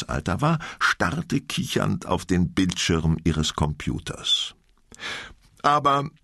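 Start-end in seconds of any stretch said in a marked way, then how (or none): 5.6-6.1 exclaiming. Acted. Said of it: "rief Vera aus".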